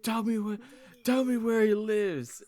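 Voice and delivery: high, loud yelling demand; a hammed-up furious shout; mock furious yell.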